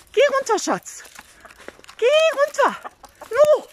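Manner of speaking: insist, high and loud, that they come down; urging call. Real, not acted. Owner losing patience with hens in the tree, high loud urging call.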